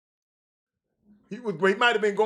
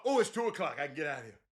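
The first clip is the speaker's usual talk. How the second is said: usual speaking voice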